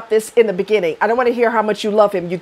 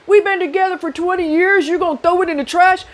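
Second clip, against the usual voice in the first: mock pleading partner; 9 semitones higher than usual. Mocking a partner's plea.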